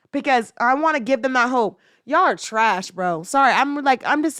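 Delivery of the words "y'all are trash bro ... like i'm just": usual speaking voice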